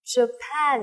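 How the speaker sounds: Japan with the weight on PAN; stress second syllable. Shown as a demonstration. Teacher drills Japan, stress on the second syllable. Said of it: "trọng âm thứ hai này".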